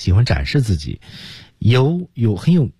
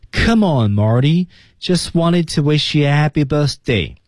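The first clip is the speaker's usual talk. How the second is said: "just wanted to wish you a happy birthday" high and joined up; high, connected model from "Come on" to "birthday"; all in one breath; 7 semitones higher, slower than usual. Shown as a demonstration. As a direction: link everything through to "birthday" slowly. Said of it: "这句话一气呵成讲出来了".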